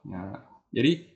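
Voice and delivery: normal talking voice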